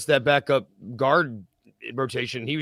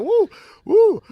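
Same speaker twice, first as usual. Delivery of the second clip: high, loud whooping woo; excited woo; 8 dB louder than usual. Acted. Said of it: "I was like".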